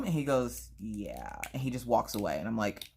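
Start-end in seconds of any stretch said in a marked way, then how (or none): 0.8-1.4 very low, soft imitated kid; unimpressed. Son dismissing mom before walking away, unimpressed. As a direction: drop it and leave.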